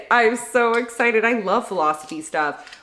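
normal talking voice

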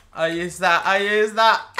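high laughing triumph; gleeful laugh. Real, not acted. Gamer laughing after recovering the pet, high and gleeful. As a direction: cackle over the win.

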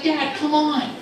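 put-on high child's plea; pleading son. Son begging dad to play werewolves, high-pitched pleading. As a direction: plead in a high little-boy voice.

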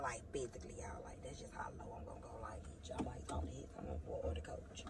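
very low, hushed mock threat; joking whispered aside. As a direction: drop to a near-whisper, joking about how low you'd go.

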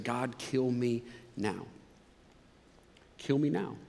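slow, soft, defeated plea; despairing plea. Retold or imitated. Elijah begging God, hushed and defeated. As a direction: let it sink low and weary. Elijah voiced wanting death.

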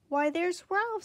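high, squeaky greeting; Aunt Sissy greets Ralph with a high squeak. A narrator's reading. Aunt Sissy spotting Ralph, high squeak. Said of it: "squeaked his Aunt Sissy".

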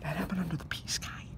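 hushed conspiratorial whisper. Acted. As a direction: lean in and whisper it. Whispered aside.

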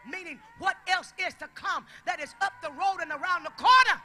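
loud, driving sermon shout; full-force preaching. Real, not acted. Preacher explaining posterity to the congregation, shouted preaching. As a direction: punch it out to the whole room.

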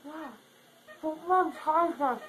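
usual speaking voice

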